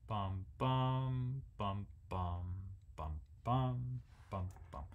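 soft sung bum bum, weight on the second; quiet chanted beats, second one stressed; stress on beat two. Shown as a demonstration. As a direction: hum it with the second beat leaned on. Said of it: "emphasize two".

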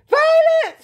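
high, loud cry of horror; scream. A narrator's reading. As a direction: scream like a panicking mother. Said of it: "screamed Missus Beauregard".

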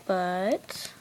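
normal talking voice

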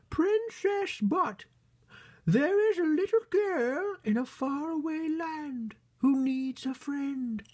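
very high, loud falsetto storybook queen; grand, piping bunny queen voice, drawn out; queen bunny character. Acted. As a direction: play a squeaky-high royal mother bunny.

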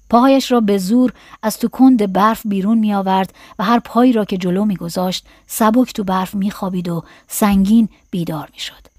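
usual speaking voice

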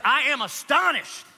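"I am astonished" pitched high, pressing on astonished; slow scolding "I am astonished"; mean, stern reading. Shown as a demonstration. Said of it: "It's like that, it's mean".

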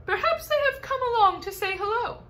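put-on high, odd voice of an old grasshopper. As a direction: play the grasshopper, high and strange. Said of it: "said the old green grasshopper".

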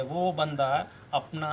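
ordinary delivery

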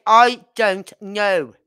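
slow, loud "I don't know" run together as one word; mock Dundee slur. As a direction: drag out "I don't know" to mock their slang. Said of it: "Now you know how I feel".

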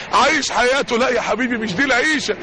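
usual speaking voice

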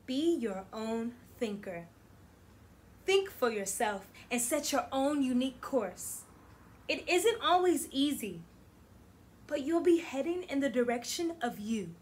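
expressive picture-book read-aloud; reading to children. Acted. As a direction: read it warmly to a class. Teacher reads picture book aloud.

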